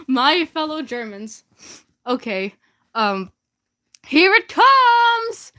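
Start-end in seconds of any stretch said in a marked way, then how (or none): 4.1-5.5 high, loud and slow; excited. Real, not acted. Gleefully announcing the hate mail to viewers. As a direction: stretch it out with glee.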